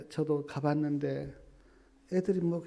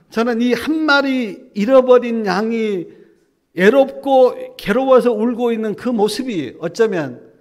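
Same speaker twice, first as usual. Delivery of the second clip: much louder, higher preaching cry; impassioned preaching; 6 semitones higher, 14 dB louder than usual. Real, not acted. Preacher crying out to the congregation about the lost sheep. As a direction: preach it out with full force.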